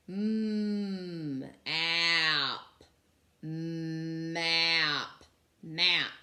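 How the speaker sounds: each sound stretched apart, then blended; sounding out slowly. Shown as a demonstration. Teacher to class, sounding out the word map. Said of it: "let's sound it out".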